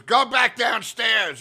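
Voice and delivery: high, drawn-out mock order; fired-up shout. Club boss sending the comic out of her office, fired up. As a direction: imitate an angry boss shouting someone away. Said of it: "she's really, like, fired up".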